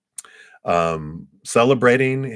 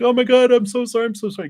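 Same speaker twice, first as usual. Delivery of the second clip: much higher, louder mock-panicked apology; hammed-up panicked wizard voice; flustered wizard; 6 dB louder, faster than usual. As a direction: squeal it out like a frazzled old wizard. Wizard apologizing in retold story.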